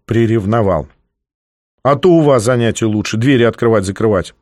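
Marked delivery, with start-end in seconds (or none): none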